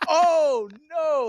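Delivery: high, loud anguished "No!"; anguished cry. Retold or imitated. Dude behind them in the theater, imitated with a high loud wail. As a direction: wail it like a heartbroken fan. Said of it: "audibly just, like, reacted".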